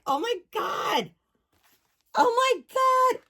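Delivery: high, cracking squeal of delight; excited squeal. Real, not acted. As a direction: squeal it out in sheer glee. Discovering dolls' birthdays.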